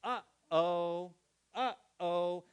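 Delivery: melodic two-note uh-oh; singsong uh-oh. Shown as a demonstration. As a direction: sing it like a little tune. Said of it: "has its own melodic pitch".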